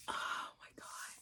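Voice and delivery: whispering, close to tears; hushed and overcome; teary whisper. Real, not acted. Overwhelmed by the gorgeous book, teary whisper.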